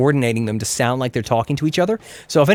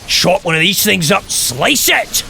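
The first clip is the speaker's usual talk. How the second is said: higher, energetic Scottish gamer; hammed-up Scottish battle commentary; Scottish accent; 5 semitones higher, slower than usual. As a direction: call the attack in a Scottish accent. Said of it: "Let's Play of this with a Scottish accent".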